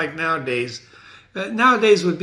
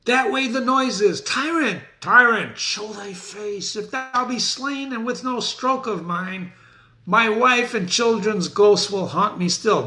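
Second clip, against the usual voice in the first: higher, urgent avenging warrior; Macduff seeking Macbeth; 7 semitones higher than usual. Acted. Teacher reading Macduff to class, higher urgent warrior voice. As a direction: hunt the tyrant with rising urgency.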